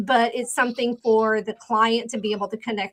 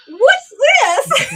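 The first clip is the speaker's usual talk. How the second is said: puzzled husband; 8 dB louder than usual. Retold or imitated. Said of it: "he's like".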